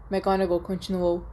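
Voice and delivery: plain reading voice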